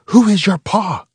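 high, loud soldier demand; mother playing a soldier, furious; intense mock interrogation. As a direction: demand it with fury. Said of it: "she said again, even more intense".

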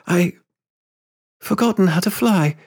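halting, high-voiced admission; apologetic. A narrator's reading. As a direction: confess it sorrily after a pause. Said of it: "she said apologetically".

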